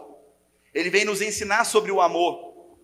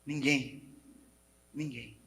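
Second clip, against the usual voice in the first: low, softer and fading to near silence; fading soft reply; 5 semitones lower, 12 dB quieter, slower than usual. Retold or imitated. Believer answering Jesus at judgment, fading softly. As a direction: let the answer die away. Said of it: "Não, Senhor, permaneci".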